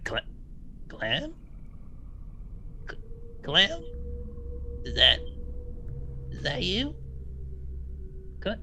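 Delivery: unsure, rising calls of a name; hesitant calling. Acted. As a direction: call out her name, hoping she answers. Bull fumbles scarf, calls dead friend.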